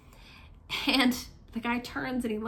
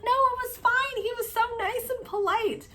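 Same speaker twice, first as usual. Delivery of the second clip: high-pitched, giggly retold reassurance; amused reassurance; 13 semitones higher than usual. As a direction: wave off the apology, voice still high with laughter.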